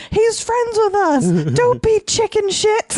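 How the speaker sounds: high, drawn-out mock yell; hammed-up scolding shout from a five-year-old; imitated child's yell. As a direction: yell it high like a little girl in a film. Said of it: "And she just yells".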